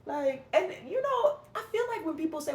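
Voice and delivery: normal talking voice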